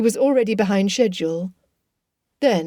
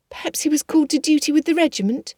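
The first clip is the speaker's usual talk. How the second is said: put-on higher, quick consoling young girl; sympathetic Kitty; 8 semitones higher, faster than usual. Kitty consoles Lydia about Wickham, quick and high. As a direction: soothe her sister, quick and high. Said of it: "Kitty made sympathetic noises".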